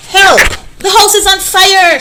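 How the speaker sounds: high, loud cry for help; panicked shout for help; boy shouting. Acted. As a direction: yell it like the house is burning. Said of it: "shouted the boy".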